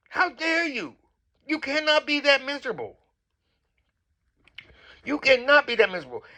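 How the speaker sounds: high, loud, scolding challenges; indignant outrage. Real, not acted. Vlogger berating a castmate over the bridal shower, outraged. Berating Heavenly for her behaviour.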